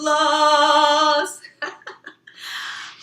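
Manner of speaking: big burst of laughter after singing; loud laugh. Real, not acted. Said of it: "Look at how that changes it".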